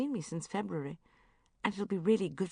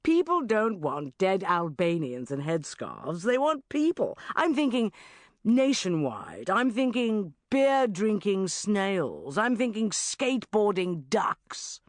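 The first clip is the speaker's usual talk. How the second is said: slow, louder, relishing each idea; Richard Finch; 2 semitones higher, 5 dB louder, slower than usual. Acted. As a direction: pitch it like a hyped editor with a vision. Richard pitching ideas.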